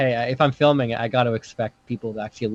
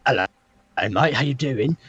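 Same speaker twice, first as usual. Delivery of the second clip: mock rehearsed Aussie greeting; terrible Australian accent. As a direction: imitate your own bad attempt at Australian. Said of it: "That's terrible".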